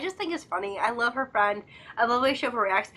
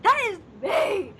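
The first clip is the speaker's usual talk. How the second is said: higher, slow "That is me!"; excited recognition; 8 semitones higher, slower than usual. Retold or imitated. Fan sees herself in the scene, excited. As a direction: shout it like you found yourself on screen. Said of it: "I'm like".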